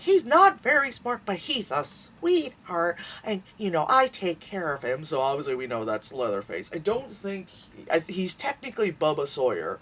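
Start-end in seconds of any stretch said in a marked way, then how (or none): 0.0-5.0 put-on old lady voice. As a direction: coo it fondly like a frail grandma. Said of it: "he's like".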